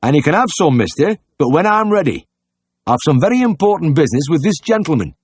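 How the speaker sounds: high, loud, put-on country voice; high-pitched, impatient publican; brusque innkeeper.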